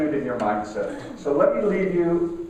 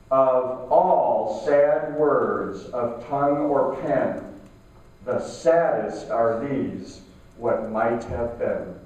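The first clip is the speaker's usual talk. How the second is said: slow poem recitation, sinking lower by the last phrase; 4 semitones lower, much slower than usual. Shown as a demonstration. Speaker repeating the closing quote for listeners to think about, very slow. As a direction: repeat the quote slowly, letting each phrase settle. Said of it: "I'm gonna say this very slowly".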